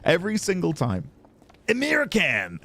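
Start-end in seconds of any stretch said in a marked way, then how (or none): 1.7-2.6 high, exaggerated American twang; American accent. Shown as a demonstration. Streamer defending the impression, exaggerated American accent. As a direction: punch out a caricatured American accent. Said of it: "It's American".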